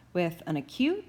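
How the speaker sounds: ordinary delivery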